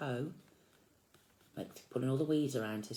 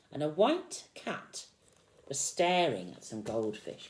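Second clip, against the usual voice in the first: slow, suspenseful read; 5 semitones higher, 5 dB louder, slower than usual. A narrator's reading. Reads the line slowly for effect.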